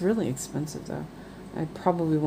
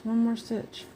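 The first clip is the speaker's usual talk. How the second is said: high voice, softer pleading repeat; 6 semitones higher, 3 dB quieter than usual. Retold or imitated. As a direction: repeat it higher and quieter, wheedling. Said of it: "It's like".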